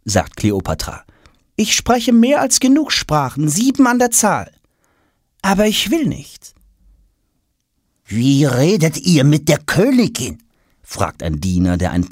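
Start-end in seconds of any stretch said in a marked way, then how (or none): 1.5-6.5 very high, lofty, disdainful queen voice; haughty queen. Acted. Kleopatra scorning Tom to her servant, haughty. 8.0-10.4 raised, reproachful servant voice; stern servant. Acted. Servant rebuking Tom for addressing the queen so.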